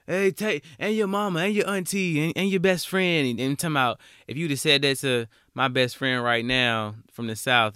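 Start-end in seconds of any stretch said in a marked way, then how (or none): 0.1-3.3 high, loud mock roast of mama and auntie; New York trash talk. Host imitates New Yorkers roasting friends, loud mock trash talk. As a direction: rattle off the insults like ribbing a buddy up North. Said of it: "talking mess to each other".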